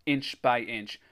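usual speaking voice

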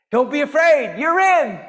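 loud, high, emphatic reassurance; jubilant proclamation. Acted. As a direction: proclaim it out to the whole crowd. Same reassurance repeated as proclamation.

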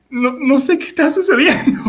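bewildered line breaking into laughter; laughing confusion. Retold or imitated. Pupil retelling his confusion to friends, laughing bewilderment. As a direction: protest helplessly through laughter.

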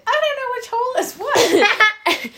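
high, slow, laughing mimic of Riley; mocking Riley's voice. Sister mocks Riley's question with a high, laughing voice. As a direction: squeak it out through giggles like a confused kid. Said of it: "'cause Riley said".

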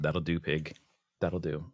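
low quiet movie-line quote, softly; Babe farmer quote. Retold or imitated. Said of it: "from Babe, you know".

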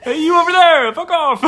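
loud, high New York yelling; New York accent. Acted. Frogs arguing outside at night, shouted New York accent. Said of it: "That was more of a New York accent".

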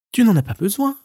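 high stoat; light high answer. Acted. Stoat answers the marmot, high and light. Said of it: "répondit l'hermine".

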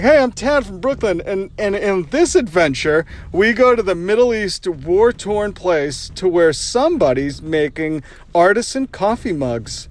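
hammed-up hipster host, high and loud; Tad, hipster anchor. Podcaster parodying a hipster anchor's travel teaser, high mock-presenter voice. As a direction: sell the adventure like an overexcited trendy web anchor. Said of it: "And they'll be like".

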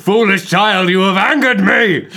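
booming wrathful god; cold rasping evil emperor. Pretend. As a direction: thunder at the child from on high. Voices Principal Zeus.

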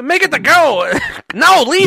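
hammed-up gangster yelling; mobster voice. Acting out the coffee scene.

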